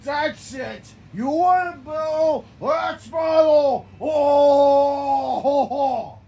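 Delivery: loud, slow, high yell then mock laugh; shouted challenge ending in laugh. Character declares battle, shouting then laughing. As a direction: bellow the challenge and cackle.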